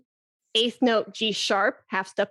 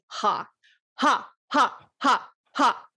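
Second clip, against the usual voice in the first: slow throaty ha pulses from the belly; vibrato haws; slower than usual. Shown as a demonstration. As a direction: push out each ha from the gut, evenly. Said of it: "It's like you're saying".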